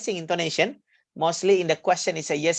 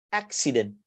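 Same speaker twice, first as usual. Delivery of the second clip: "accident" with weight on AC, softer falling tail; first-syllable stress; 4 semitones lower, 5 dB quieter than usual. Shown as a demonstration. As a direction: stress the AC in "accident", then drop away. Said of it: "here you stress".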